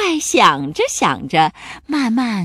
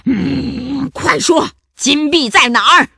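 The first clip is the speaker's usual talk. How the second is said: growl, then loud high demand; angry shout; 4 semitones higher, 6 dB louder, slower than usual. A narrator's reading. Pirate captain demanding the gold from the monster, shouting. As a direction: interrogate with a forceful bark. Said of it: "头目汉克大叫道".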